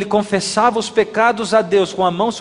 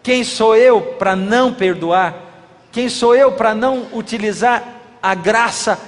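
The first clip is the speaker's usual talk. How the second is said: normal talking voice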